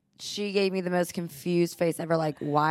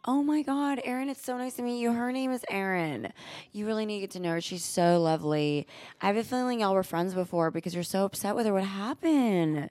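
high, soft, gushing niceness; mock-chummy greeting in a raised pitch; feigned friendliness; 4 semitones higher, 4 dB quieter than usual. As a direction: play sweetly innocent, killing her with kindness. Feigned friendliness to hostile woman retold.

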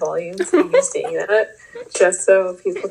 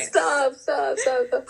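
laughing protest; 5 semitones higher, much slower than usual. Real, not acted. Embarrassed by the praise.

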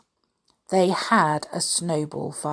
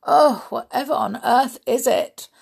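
grumbled; 7 semitones higher, 4 dB louder than usual. Acted. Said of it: "grumbled Mister Nonsense".

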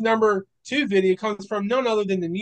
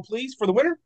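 usual speaking voice

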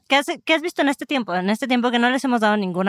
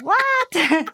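mock shock; 9 semitones higher than usual. Retells her own shocked reaction.

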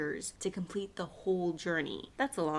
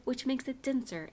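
usual speaking voice

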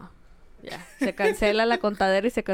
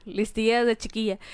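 ordinary talk, nothing marked

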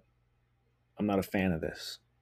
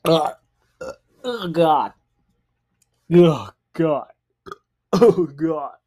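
disgusted groan; 8 semitones higher, 12 dB louder, much slower than usual. Real, not acted. Drinking spat-out shot.

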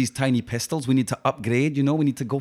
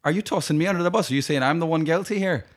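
higher-pitched wounded disbelief; indignant accusation; 6 semitones higher than usual. Acted. Gang member betrayed by his mate, indignant. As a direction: fire questions at your betrayer.

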